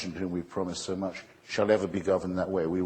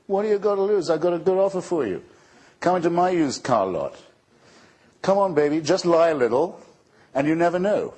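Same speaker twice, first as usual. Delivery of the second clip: much higher, louder hammed-up huckster pitch; sleazy used-car salesman; 10 semitones higher, 7 dB louder than usual. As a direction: ham up a slimy car salesman reeling in a buyer. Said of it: "religious hucksterism of the cheapest, vulgarest, nastiest kind".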